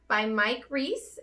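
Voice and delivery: usual speaking voice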